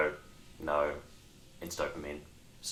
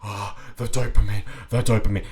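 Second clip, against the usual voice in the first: dazed addict; 3 semitones higher, 8 dB louder than usual. Retold or imitated. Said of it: "it's just like".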